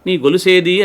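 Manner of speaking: sharp demand. A narrator's reading. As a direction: snap the question at her daughter.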